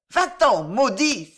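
high, loud dismissal; loud, high-pitched angry curse; crying out angrily. A narrator's reading. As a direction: cry it out to drive them away. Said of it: "s'écria Maria".

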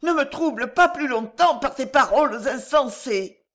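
high-pitched desperate plea; distraught, high entreaty; imploring. A narrator's reading. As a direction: beg to be left alone, voice pitched high. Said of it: "implora de nouveau la jeune femme".

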